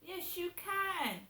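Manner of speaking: a slow knowing contradiction; sarcastic retort. Retold or imitated. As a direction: drag it out, dripping with disbelief. Retorting to the soundproof claim.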